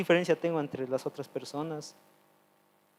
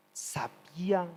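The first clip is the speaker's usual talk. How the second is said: whispered emphasis; 4 semitones higher, 5 dB quieter, much slower than usual. Real, not acted. Preacher stresses God knew.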